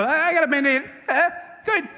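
high, loud comic denial; comic false cheer. Retold or imitated. Person with a broken leg insisting a Band-Aid suffices, comically high. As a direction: pitch it up and play absurdly unbothered.

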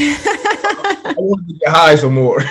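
high, loud words breaking into laughter; laughing confession. Real, not acted. Guest laughing while admitting he wanted to get high first. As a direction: laugh through the admission. Laughing at his own past excuse.

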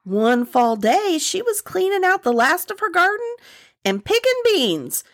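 lilting folktale narration; Southern story narration. Acted. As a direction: spin the tale for the listeners. Reads the story aloud.

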